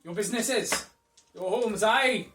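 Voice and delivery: formal speech. Acted. Continues the crowd speech.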